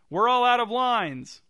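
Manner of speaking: high, loud, drawn-out shout; calling out. A narrator's reading. Jerry answering the chanting husband, calling out. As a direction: shout it slowly to the back row. Actor calling back to heckler.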